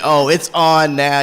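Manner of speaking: mock challenge. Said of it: "and you'll be like".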